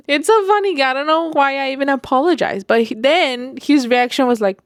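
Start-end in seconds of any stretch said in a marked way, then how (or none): 0.0-2.7 high, giggly, rushed; laughing. Real, not acted. Woman laughing at herself for apologizing to the neighbor. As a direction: laugh through it at your own silliness.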